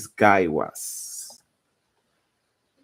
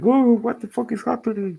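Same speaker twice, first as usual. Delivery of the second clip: much higher, fast, squeaky panicked golem voice; 6 dB louder, faster than usual. Retold or imitated. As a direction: shriek it like a confused monster. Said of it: "the other golem is like".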